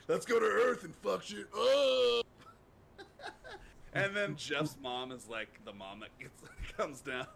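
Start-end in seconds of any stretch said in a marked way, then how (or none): 0.1-2.3 loud, high, slow rallying cry; rowdy god. Pretend. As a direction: whip up the party, loudly. Imagining himself as a god.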